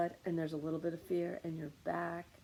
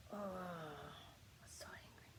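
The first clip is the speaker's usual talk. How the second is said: whispered anger while squeezing the whole body; hushed, drawn-out seething; strained angry whisper; 13 dB quieter, much slower than usual. Pretend. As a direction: groan it out in a breathless whisper.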